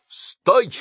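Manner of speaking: captain shouting at fleeing figures. Acted. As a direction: halt them with a shout. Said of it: "крикнул капитан".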